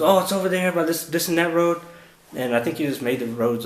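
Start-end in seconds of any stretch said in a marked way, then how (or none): 0.0-1.8 higher, louder, offhand cop; mock vague directions. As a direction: toss off made-up directions lightly. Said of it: "He goes".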